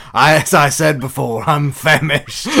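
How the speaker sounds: high, loud in-character protest; exasperated hunger. Acted. Hungry adventurer excuses himself to barkeep, loud protest.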